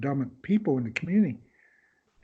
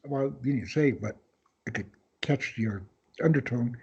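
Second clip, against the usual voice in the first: ordinary delivery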